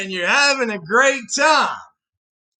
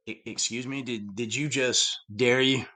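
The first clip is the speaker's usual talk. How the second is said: mock shocked; 9 semitones lower, 8 dB quieter than usual. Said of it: "everybody's like".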